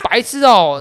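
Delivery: high, loud playful insult; 模仿骂人. Retold or imitated. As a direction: reenact the drunk's jab. 重演醉友骂白痴. (高声戏谑骂白痴)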